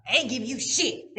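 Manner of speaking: gruff dad. Retold or imitated. Daughter imitates her father refusing money, gruff dad. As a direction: bark it as the stubborn dad.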